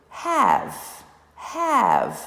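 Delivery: one clear syllable, jaw dropping once; demonstrated have. Shown as a demonstration. Teacher showing the chin trick with have, loud and high. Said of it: "por exemplo, eu falei".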